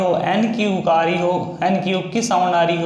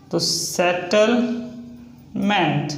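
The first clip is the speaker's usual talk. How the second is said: drawn out; much slower than usual. Shown as a demonstration. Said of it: "teacher draws word while saying it".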